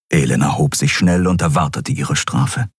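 ordinary delivery, nothing marked

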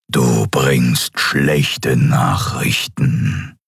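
very slow, low distorted emperor; menacing, warped dark lord. Acted. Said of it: "sagte seine verzerrte Stimme". (verzerrte, tiefe Herrscherstimme)

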